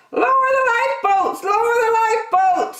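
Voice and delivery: very high squawking parrot. A narrator's reading. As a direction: screech it like a panicked parrot. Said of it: "squawked the parrot".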